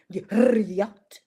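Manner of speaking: "yacht" with a mock Russian trilled R; Russian accent. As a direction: roll a big Russian R into "yacht". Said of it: "How she say yacht?".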